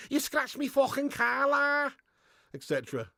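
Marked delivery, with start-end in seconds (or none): 0.1-1.9 higher-pitched mock Scouse; Scouse accent. Liverpudlian complaining about his car, mock Scouse.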